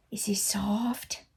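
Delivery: gentle whispered question. Real, not acted. Asking Jelly Bean about the blanket, whispered. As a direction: hush it to the cat.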